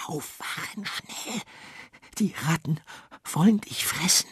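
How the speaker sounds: hushed, urgent wake-up call; urgent whisper. A narrator's reading. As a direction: whisper the warning fast and high. Worm wakes the bird urgently. (dringendes Flüstern)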